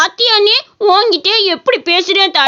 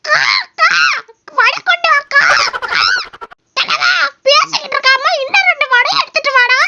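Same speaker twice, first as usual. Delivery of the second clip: high, cartoon crow begging for vadai; cawing crow voice; 6 semitones higher than usual. A crow demanding vadai from the old man, high crow voice. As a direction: squawk it like a greedy crow, high-pitched. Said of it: "Kaaka பேசுதுன்னு".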